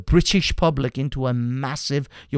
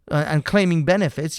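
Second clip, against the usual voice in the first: ordinary talk, nothing marked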